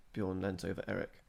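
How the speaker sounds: ordinary delivery, nothing marked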